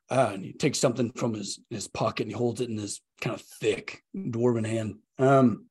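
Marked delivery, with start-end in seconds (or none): none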